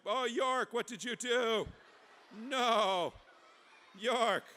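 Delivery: high, loud cry of dismay; mock dismay. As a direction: wail it loudly, high-pitched. Mock dismay at York's belly.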